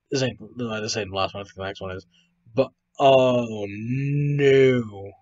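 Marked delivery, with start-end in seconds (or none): 2.5-5.1 very slow, drawn-out "oh no"; dismayed. Real, not acted. Host seeing the next photo, drawn-out dismay. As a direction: stretch it out in dismay.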